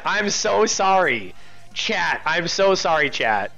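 usual speaking voice

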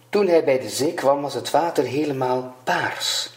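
usual speaking voice

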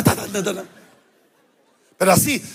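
usual speaking voice

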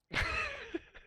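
high burst of disbelieving laughter; incredulous laugh. Real, not acted. Laugh at teammates' play.

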